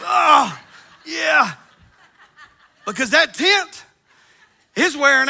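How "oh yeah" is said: loud high groan turning into a laugh; a self-mocking groan while getting up; groan rolling over. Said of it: "and it's".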